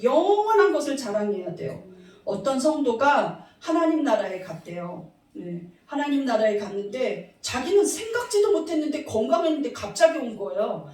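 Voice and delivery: ordinary delivery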